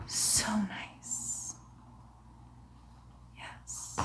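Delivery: soft, savouring whisper; whispering in creepy delight; rapt whisper. Acted. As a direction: breathe it out as if entranced. Surgeon savours heartbeat.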